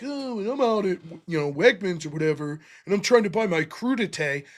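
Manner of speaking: lightly imitated candidate in his ad; lightly mock an out-of-touch politician shopping for crudité; low-key impression of a rich politician; mock wealthy candidate. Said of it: "Where he's like".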